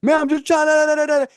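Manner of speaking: high-pitched imitated whining excuse; mock protest. Teammate defending himself in the quarrel, mock protest.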